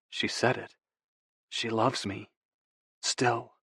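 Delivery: soft, halting realisation; softly awed inner voice; hushed wonder. A narrator's reading. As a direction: let it sink in quietly, pausing between phrases. Narrator moved by her confession.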